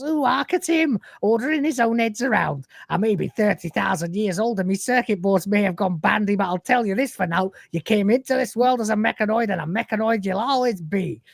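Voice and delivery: high indignant Yorkshire scold; Yorkshire accent. Retold or imitated. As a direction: scold him like a grumpy Yorkshire elder. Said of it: "a thick Yorkshire accent".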